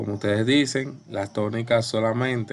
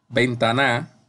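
ventaNA, rising onto a forced last syllable; stress on final NA. Shown as a demonstration. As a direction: throw the force onto the end of ventana: ven-ta-NA. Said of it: "si la pongo en la última".

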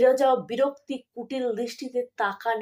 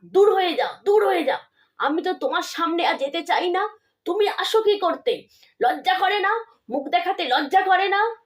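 raised, furious voice driving them away; angry outburst; 5 semitones higher, 3 dB louder than usual. Acted. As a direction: shout at them to get out, higher and louder. Mother raging at her visitors.

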